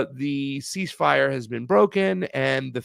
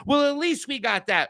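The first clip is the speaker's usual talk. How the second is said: mocking liberal voice; 10 semitones higher than usual. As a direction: parody the smug progressive who settles for crumbs. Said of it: "they almost sound like shit libs or progressives".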